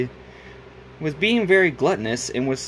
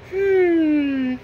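high, held thinking hum; thinking hmm; 13 semitones higher, 5 dB louder than usual. Acted. Cuphead pondering the plan, a held high hmm. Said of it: "thought to himself".

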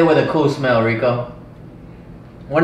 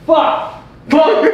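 startled curse; 11 semitones higher than usual. Real, not acted. Sudden reaction to a mishap.

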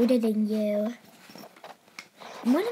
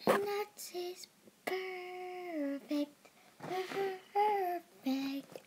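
slow soft admiring; 4 semitones higher, 6 dB quieter, much slower than usual. Acted.

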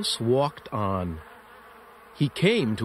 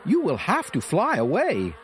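much higher, small mouse voice; squeaky mouse voice; 10 semitones higher than usual. Acted. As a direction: voice the little mouse, high and bright. Mouse speaks in story.

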